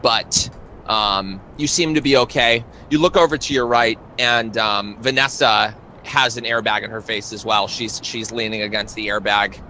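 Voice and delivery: ordinary talk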